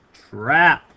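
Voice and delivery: "trap" set apart and punched loud; emphasized trap. Shown as a demonstration. Teacher revealing the memory trick to the class, "trap" strongly emphasized. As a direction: land hard and slow on the word "trap". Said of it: "I really emphasize that word trap".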